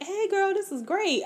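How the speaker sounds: high, bubbly fake praise; gushing friend impression. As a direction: chirp it like a friend who skimmed the book. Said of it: "Sabaa is just like".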